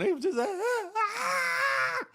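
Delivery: high mock wail of pain; hammed-up tortured cry. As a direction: imitate someone being tortured, up high. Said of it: "like they was getting tortured".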